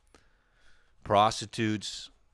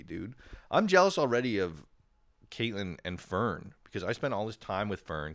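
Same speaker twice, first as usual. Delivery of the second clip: ordinary talk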